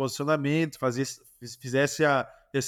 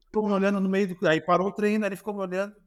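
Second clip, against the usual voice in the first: ordinary talk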